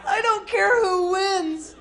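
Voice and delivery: high, loud and drawn-out; hammed-up melting delight; mock gushing. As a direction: gush helplessly over the cute fighters. Gushing over the cute fighters.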